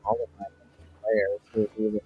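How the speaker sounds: ordinary talk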